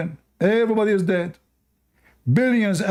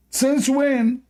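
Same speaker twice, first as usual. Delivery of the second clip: mock surprise; 9 semitones higher than usual. Real, not acted. Mocking opponent's claim.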